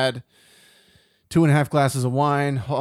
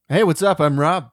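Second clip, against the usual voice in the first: excited; 4 semitones higher, 7 dB louder than usual. Pretend. Mock podcast intro.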